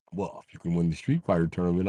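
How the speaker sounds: ordinary delivery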